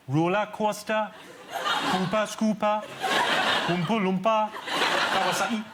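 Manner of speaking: stretched Geordie vowels, word by word; Geordie accent. Shown as a demonstration. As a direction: say each in broad Newcastle accent, drawn out. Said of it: "do the Geordie accent".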